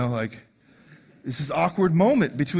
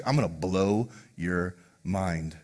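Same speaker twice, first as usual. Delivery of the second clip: low slow tease; 12 semitones lower, 8 dB quieter, slower than usual. Acted. Jesus teasing Nathanael.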